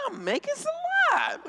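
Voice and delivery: very high, drawn-out whine; mock protest. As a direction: protest high, dragging out the words. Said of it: "I was like".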